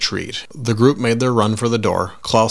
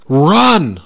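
sudden loud warning shout; 8 semitones higher, 9 dB louder than usual. A narrator's reading. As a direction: yell it out urgently.

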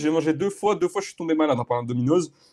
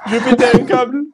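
laughing hard; 8 semitones higher than usual. Real, not acted. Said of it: "J'ai un fou rire de fou".

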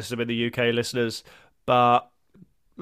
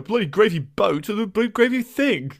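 put-on a light Radio Four posh English accent, pitched higher; mock a Radio Four presenter with a posh English accent; 7 semitones higher than usual. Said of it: "That was a good accent".

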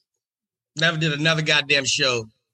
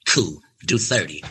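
lower, calm and in charge; low cool Katt Williams voice; 8 semitones lower than usual. Retold or imitated. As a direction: ask like the man running the night. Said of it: "Katt speaking".

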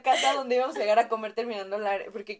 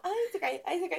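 teasing; 7 semitones higher, 3 dB quieter than usual. Retold or imitated.

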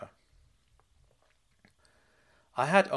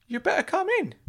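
put-on much higher, loud excited old uncle voice; high, loud eager uncle; Uncle Claude calling out; 4 dB louder than usual. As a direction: squeal his name like a giddy fussing uncle.

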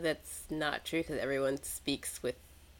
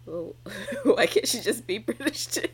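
laughing; 7 semitones higher, 7 dB louder than usual. Retold or imitated. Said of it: "and I'm like".

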